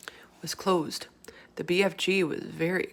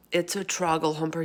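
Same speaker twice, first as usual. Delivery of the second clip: plain reading voice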